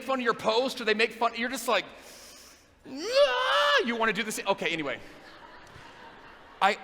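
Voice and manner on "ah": high loud battle yell; angry yell. Pretend. Answering online haters with an angry high yell. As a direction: let out a sudden fighting yell.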